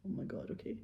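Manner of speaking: breathless whispered reply. Retold or imitated. Whispering back to a scolding grandmother. Narrator reacting to babcia's scolding.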